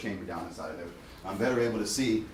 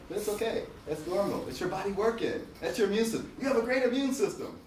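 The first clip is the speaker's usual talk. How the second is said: higher, bright cheering-up tone; upbeat reassurance; 9 semitones higher than usual. Shown as a demonstration. Dentist modelling how to reassure a patient, upbeat. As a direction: lift the patient's spirits. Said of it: "You gotta say it like that".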